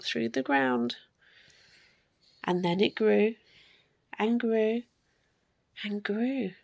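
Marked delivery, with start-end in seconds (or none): 2.4-6.5 fading. A narrator's reading. Storyteller fading sprout growth.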